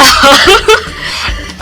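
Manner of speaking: quick, breathy, high giggle; giggle, caught off guard. Real, not acted. Embarrassed laugh after teasing.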